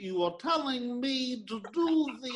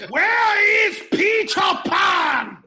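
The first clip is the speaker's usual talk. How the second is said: wailing shout; 9 semitones higher, 9 dB louder, slower than usual. Acted. Friends shouting movie impressions.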